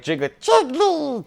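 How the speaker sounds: high, drawn-out cartoon creature cry; Jigglypuff impression. Fan breaking off to mimic Jigglypuff, high creature voice. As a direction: squeal the name in a cute put-on voice.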